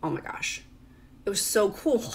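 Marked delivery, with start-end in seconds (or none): none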